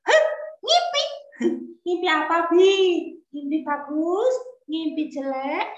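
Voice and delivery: put-on high, curious child's voice; a boy pestering his father with questions. Young Ismail asking his father about the dream in a high child voice.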